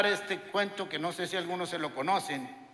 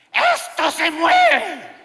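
high, loud, slow shriek of alarm; wife yells that the building moves, mock-terrified; panicked shout; 11 semitones higher, 10 dB louder, much slower than usual. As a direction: imitate the wife crying out in fright.

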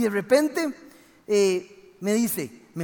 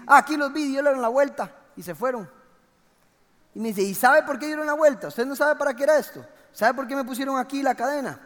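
put-on excited old guard; 6 semitones higher, 6 dB louder, faster than usual.

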